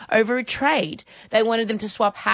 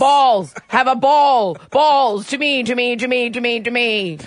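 loud, slow chatbot chant; robot voice; 3 dB louder, slower than usual. Pretend. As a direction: bark the balls like a broken robot. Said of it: "you have to do a robot voice".

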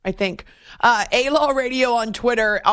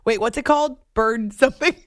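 ordinary talk

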